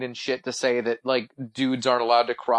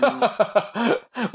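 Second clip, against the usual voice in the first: amused laugh; 9 semitones higher than usual. Real, not acted. Laughs at own crude remark.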